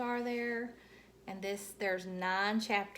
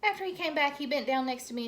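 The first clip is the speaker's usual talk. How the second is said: usual speaking voice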